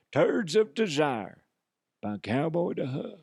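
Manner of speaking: slow, drawn-out parody ad read; mock commercial announcer. Host advertising Turds of Desire by Cowboy Duff, mock announcer. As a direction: pitch it like a spoof product commercial, with long pauses.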